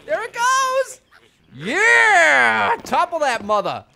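high, loud cheering; excited cheer. Real, not acted. Gamer cheering as the tower falls, loud and high.

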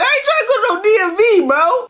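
high whiny laugh-cry over the DMV line; mock despair. Comic laments losing his ID to the crowd, mock crying. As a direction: wail it like a hammed-up sob.

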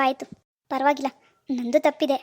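ordinary delivery

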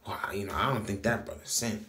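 low, hushed wondering voice; imagined onlooker's musing. Retold or imitated. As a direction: murmur it like an admiring onlooker. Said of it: "You may look at us and say".

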